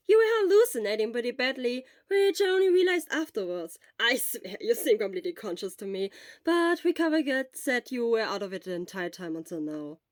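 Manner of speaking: loud, quick, eager friend; loud, urgent friend's voice; Hisashi, loud. Acted. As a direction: push it out fast and loud at the sick friend.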